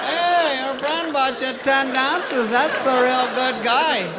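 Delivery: high, slow hammed-up worn-out stripper; rough stripper voice. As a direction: play her as a tired old-timer. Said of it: "this pops out of the back".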